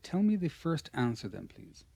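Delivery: ordinary delivery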